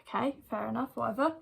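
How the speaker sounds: ordinary talk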